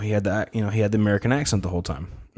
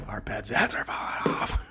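whispered movie quote; 7 dB quieter than usual. Retold or imitated. Quoting Dumb and Dumber.

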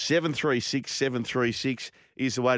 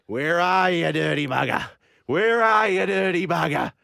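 put-on loud broad Aussie accent, higher; 6 semitones higher, 7 dB louder than usual. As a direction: shout it in a broad Australian accent. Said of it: "so he's in an Aussie accent".

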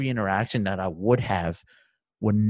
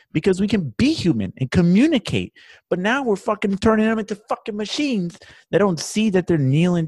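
raised, loud furious rant; angry rant; 8 semitones higher, 4 dB louder than usual. Real, not acted. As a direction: shout it out in real anger. Angry rant about police.

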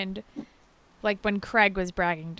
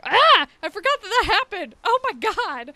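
much higher, louder shocked gasp of a reaction; retold shock; 12 semitones higher, 9 dB louder than usual. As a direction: replay your jaw-drop at the slap. Retelling own shocked reaction.

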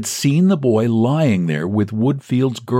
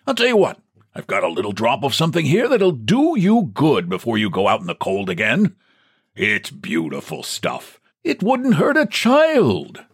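the boss jests kindly with a raised, playful voice; jokingly; 6 semitones higher than usual. Acted. Boss offering whisky to old clerk, jokingly. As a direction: tease him like an old friend. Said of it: "said jokingly".

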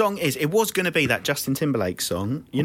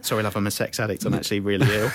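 mock apologetic; 8 semitones lower, faster than usual. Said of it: "It wouldn't have been as catchy".

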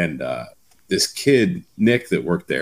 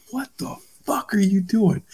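angry yell; 13 semitones higher than usual. Retold or imitated. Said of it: "So I'm like".

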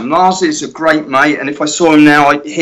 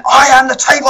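much higher, loud snarling start of the line; menacing voice of God; 10 semitones higher, 4 dB louder than usual. Retold or imitated. James recording the vocal, suddenly in full menace. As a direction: hit it like the furious voice of God. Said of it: "went into character".

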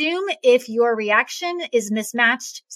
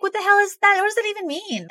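higher, puzzled disbelief; baffled; 9 semitones higher, faster than usual. Retold or imitated. Retelling her own confusion at learning about somatic reactions, baffled and higher. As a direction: sound utterly lost at a new word. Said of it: "I was so baffled by this concept".

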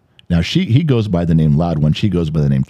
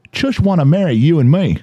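high, run-together mock Australian; thick Australian accent; 9 semitones higher than usual. Host imitating friend reading a text, thick Australian accent. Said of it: "his Australian accent is so thick".

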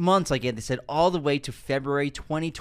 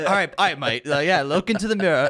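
put-on British accent; 4 dB louder than usual. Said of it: "Was that Ridley Scott's British accent?".

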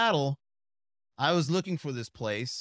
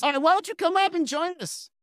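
much higher host voice calling him up; mock bingo host; 12 semitones higher, 4 dB louder than usual. Drag host inviting the newcomer up. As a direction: call the straight guy up to the stage.

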